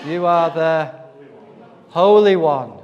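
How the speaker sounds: loud, emphatic demon's declaration; crying out. Acted. Demon recognising who Jesus is, crying out. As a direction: proclaim it loudly, landing hard on Holy.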